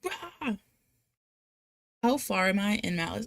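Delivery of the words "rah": high, short playful roar; half-hearted comic growl; mock roar. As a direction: let out a little monster "rah". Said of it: "I'm just like".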